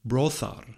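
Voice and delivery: "brothar" said slowly with th as in thin; voiceless middle fricative. Shown as a demonstration. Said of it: "with a voiceless fricative in the middle".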